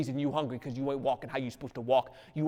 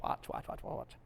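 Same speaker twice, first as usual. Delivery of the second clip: breathy whispered watch, watch; soft hush to the crowd; 10 dB quieter than usual. Real, not acted. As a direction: lean in and whisper it. Preacher hushes the congregation.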